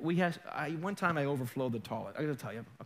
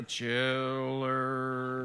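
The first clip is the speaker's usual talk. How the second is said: low, long-drawn horror title; spooky TV-show voice; 5 semitones lower than usual. Retold or imitated. Preacher imitating a scary TV program's intro, slow spooky voice. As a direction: draw the word out eerily. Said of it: "there used to be this program".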